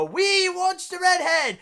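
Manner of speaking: high, hammed-up pirate chant; mock a rowdy pirate crowd calling out; rowdy pirate crowd shouting for the redhead; leering pirates. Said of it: "ogle at her and go".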